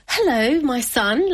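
a hint of a mother welcoming warmly; mum's greeting. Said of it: "like that".

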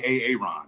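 "A Aaron" said as ay-ay-RON; Key and Peele mispronunciation. Retold or imitated. Guest quoting his comedy-sketch nickname, "A Aaron" as ay-ay-RON. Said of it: "because of Key and Peele".

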